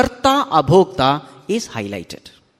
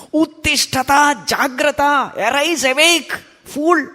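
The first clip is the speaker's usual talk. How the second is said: high, ringing exhortation; chant-like call to rise and wake; voice of scripture; 9 semitones higher than usual. Acted. Scripture rousing the sleeping seeker, high exhorting cry. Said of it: "This is what the scripture says".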